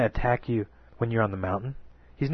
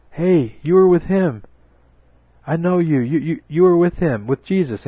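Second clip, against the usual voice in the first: high, loud, pointed accusing cry; loud accusation; 8 semitones higher, 9 dB louder than usual. Acted. Bystander at the fire accusing Peter, loud and high. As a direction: call him out in front of everyone.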